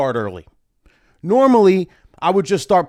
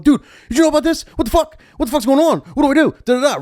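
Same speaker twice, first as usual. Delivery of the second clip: fast, high, louder panic; frantic text; 12 semitones higher, 4 dB louder, faster than usual. Retold or imitated. DJ texting his booker in a panic, fast and high. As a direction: rattle it off in a panic.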